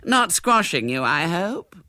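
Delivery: high, fluting politeness; trilling, well-mannered lady; polite inquiry. A narrator's reading. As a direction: inquire with gushing courtesy. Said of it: "she inquired politely".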